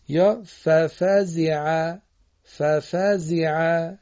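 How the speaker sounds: ففزع very slow, the z carrying a long ee, fafazee-a; Arabic kasra. Shown as a demonstration. Teacher models Quranic pronunciation for pupils, very slow. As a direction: spell out ففزع syllable by syllable.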